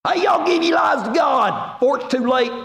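mock Texas angel shouting a warning; Texas accent. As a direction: yell it like a Texan angel hollering from the sky. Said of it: "Maybe one of them will have a Texas accent".

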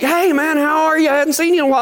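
bright, high friendly hail; high cheery hey man; buddy's cheery greeting. Retold or imitated. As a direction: greet him like an old pal across the room. Buddy greets him loudly, high.

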